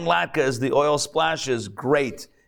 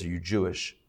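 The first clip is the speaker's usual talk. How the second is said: Southern drawl; 6 semitones lower, 9 dB quieter than usual. Retold or imitated. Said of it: "Real Southern boy".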